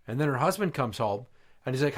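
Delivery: normal talking voice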